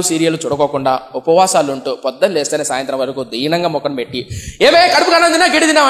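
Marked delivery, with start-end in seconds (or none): 4.6-5.9 a hammed-up husband yelling at his wife; mock angry husband. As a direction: play the grumpy husband barking, half laughing. Fasting wife snapped at by husband.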